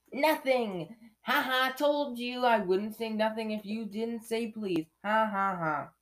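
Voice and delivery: loud, high triumphant crowing; high-pitched poltergeist taunt; gloating Peeves. Acted. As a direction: crow it with a mocking ha-ha. Peeves gloating over his trick.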